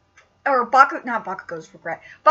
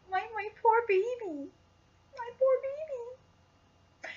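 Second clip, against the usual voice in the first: soft tearful murmur; 4 semitones higher, 15 dB quieter, slower than usual. Real, not acted. Crying softly over Bakugo.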